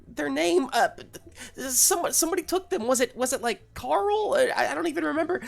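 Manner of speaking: high, fast, stammering cartoon bird; flustered high-pitched rambling; panicky bird. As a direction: babble frantically in a high bird voice. Bird Josie explains in a panic.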